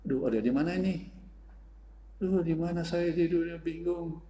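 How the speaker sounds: put-on bewildered girl. The freed girl wakes asking where she is, bewildered. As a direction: wake up confused and lost. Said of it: "dia bingung".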